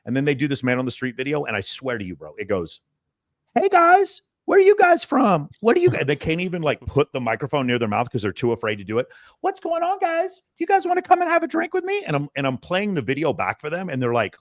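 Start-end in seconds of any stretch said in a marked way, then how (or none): 3.6-6.1 much higher, thin voice, mocking a timid guy; nervous student impression. Coach mocking a nervous student's street approach, high timid voice. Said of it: "you sound like a little fucking girl". 9.4-12.0 much higher, thin voice, mocking a timid guy; nervous student impression. Coach mocking a nervous student's street approach, high timid voice. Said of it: "you sound like a little fucking girl".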